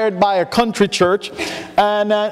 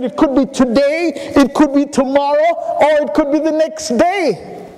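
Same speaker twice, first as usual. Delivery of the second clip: higher, building rise on each could be; a rookie who thinks he has him; pleased young preacher; 6 semitones higher than usual. Retold or imitated. Young preacher answering the farmer, pleased and higher.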